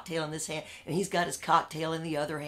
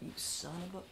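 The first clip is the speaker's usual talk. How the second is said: softer whispered insult; whispered curse; 11 dB quieter, faster than usual. Retold or imitated. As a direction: breathe the curse under your breath. Muttered curse at the bosses.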